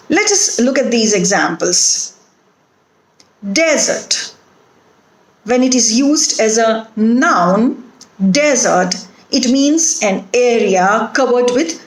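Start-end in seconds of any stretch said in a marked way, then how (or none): 3.5-4.3 DESERT stressed on the first syllable, DEZ-ert. Shown as a demonstration. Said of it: "the stress is on the first syllable". 8.3-9.0 desert as DEZ-ert, noun stress; stress on first syllable. Shown as a demonstration. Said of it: "the stress is on the first syllable".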